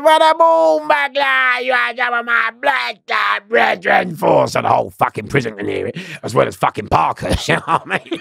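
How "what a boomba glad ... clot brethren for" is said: loud, high, slow Jamaican patois yell; shouted Jamaican patois. Retold or imitated. Gang member yelling so the whole prison hears, Jamaican patois. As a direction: bellow it in Jamaican patois like a foghorn. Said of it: "So he goes".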